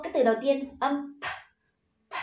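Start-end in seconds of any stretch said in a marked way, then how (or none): 2.1-2.1 p as a bare puff of air, no voice; aspirated voiceless p. Shown as a demonstration. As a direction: pop a breathy unvoiced p. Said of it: "âm bật hơi và vô thanh". (p bật hơi, vô thanh)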